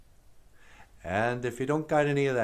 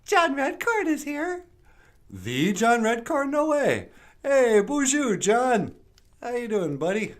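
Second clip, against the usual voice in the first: very high, loud cartoon puppet greeting; high puppet bonjour; 11 semitones higher, 4 dB louder than usual. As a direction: squeak it out like a bubbly puppet. Puppet character voice.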